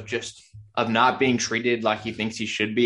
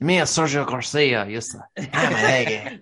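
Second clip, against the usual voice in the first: mock Spanish accent; 3 semitones higher than usual. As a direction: lay the bad Spanish accent on thicker. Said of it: "You're making it worse".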